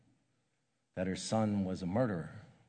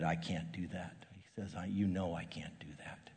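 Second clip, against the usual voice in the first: a hushed, breathy whispered refusal; 3 semitones lower, 8 dB quieter than usual. Retold or imitated. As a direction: voice dropping to a whisper of despair.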